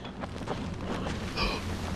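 sharp breathless gasp; startled gasp. Real, not acted. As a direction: gasp in fright. Sudden scare.

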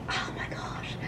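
whisper. Retold or imitated. Cringing at dad's teasing.

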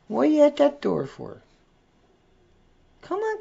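very high pet-talk voice; coaxing a pet. Real, not acted. Host calling Zoe over to Dana, high coaxing. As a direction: coax the pet over in a high sing-song.

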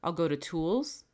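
ordinary talk

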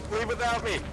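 high, frantic calls to flee; panicked shouting. Real, not acted. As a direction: yell at the team to escape. Fleeing to the ship.

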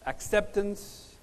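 ordinary talk, nothing marked

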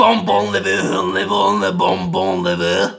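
loud, hammed-up foreign gibberish accent; fake European accent. Showing how Europeans speak, loud fake European accent. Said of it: "How do they speak then?".

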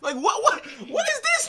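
high laughing shout of disbelief; shouting through laughter. Real, not acted. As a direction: laugh out what is this.

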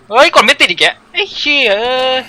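high, loud angry outburst; frustrated yell. Real, not acted. A gamer curses as the button fails again. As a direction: curse at the broken controls.

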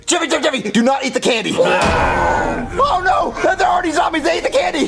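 high-pitched alarmed cry; panicked warning. Acted. Warning too late, sees zombies.

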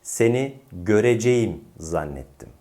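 "göreceğim" spelled out as gö-re-ce-yim; over-careful full vowels in "göreceğim"; wrong as-spelled pronunciation. Shown as a demonstration. Teacher repeats the mistake, "göreceğim" as spelled. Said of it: "Bu yanlış olan telaffuz".

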